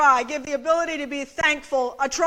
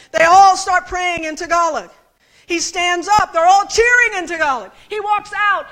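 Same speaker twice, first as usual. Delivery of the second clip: louder, rising wonder as he stands; preacher retelling a miracle, louder and rising; building excitement; 3 semitones higher, 8 dB louder than usual. Real, not acted. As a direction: swell toward a triumphant climax. Man healed, room erupts.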